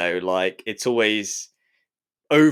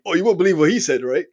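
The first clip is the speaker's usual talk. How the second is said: put-on light Geordie accent, fast and high; a dialed-down Geordie drunk; mild Geordie accent, quick and raised; 7 semitones higher, 5 dB louder, faster than usual. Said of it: "that was a Geordie accent".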